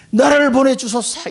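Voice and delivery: 기뻐 외치듯; joyful plea. Acted. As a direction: leap at the call with joy. Joyful Isaiah prayer.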